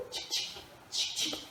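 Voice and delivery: whispered "tchic, tchic" clicks; camera click noises. Pretend. Teacher pretending to photograph the word, clicking softly. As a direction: mimic a camera shutter, unvoiced. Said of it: "Je prends le mot en photo".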